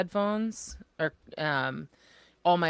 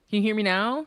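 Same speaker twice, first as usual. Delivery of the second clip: louder check; 5 semitones higher, 6 dB louder than usual. Real, not acted. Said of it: "tell us if you can hear".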